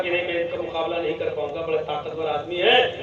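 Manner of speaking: soft, quick frightened mutter; a beaten man muttering as he runs; quick low mutter of a man running away; fleeing man's hushed aside. Acted. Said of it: "وہ بھی جان بچا کے بھاگا".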